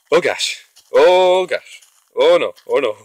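real fright while under attack; panicked. Real, not acted.

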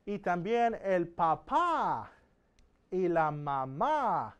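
slow, "papá" and "mamá" with the stress landing on the last syllable; stressed final syllables. Shown as a demonstration. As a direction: slowly hit the accented ending of "papá" and "mamá". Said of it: "There's that accent mark".

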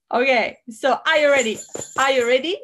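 normal talking voice

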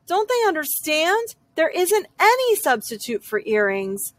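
ordinary delivery, nothing marked

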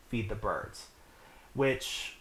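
ordinary talk

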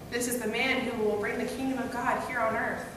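ordinary talk

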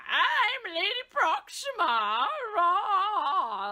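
put-on slow, high little old lady voice; Lady Proxima voice. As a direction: croak it out like a grandma. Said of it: "She sounds like a little old lady".

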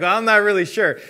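a little nervous. Acted. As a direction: play a scholar who is slightly nervous. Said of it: "he gets a little nervous".

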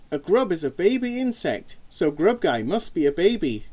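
ordinary delivery, nothing marked

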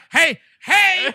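high, loud panicked call; screaming for attention. Retold or imitated. Rider to attendant about the unlocked bar, screaming. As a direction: yell out to stop the ride. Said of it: "And I have to scream".

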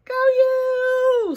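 high, loud squeaky cheer; little mouse voice. Acted. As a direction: cheer like a tiny excited mouse. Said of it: "says the little mouse".

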